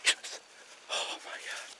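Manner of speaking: hushed hunter's whisper. Real, not acted. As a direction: whisper so the deer can't hear. Whispering in the hunting stand.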